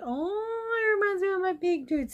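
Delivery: put-on squeaky high baby-talk gush; high cutesy voice. Cooing over a cute mouse figurine in a high voice. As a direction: coo it up high like seeing something adorable. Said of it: "I'm like".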